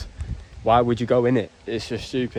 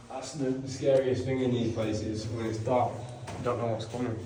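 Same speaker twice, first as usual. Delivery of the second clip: hushed; 8 dB quieter than usual. Real, not acted. Dark cave, spooky.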